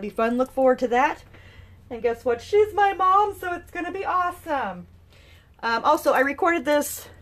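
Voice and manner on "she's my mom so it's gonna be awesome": high, excited gushing; thrilled, pitched right up high; excited announcement. Real, not acted. Podcaster telling listeners her mom will co-host, excited.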